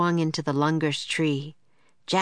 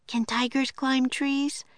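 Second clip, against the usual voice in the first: Annie asking; 8 semitones higher than usual. A narrator's reading. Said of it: "asked Annie".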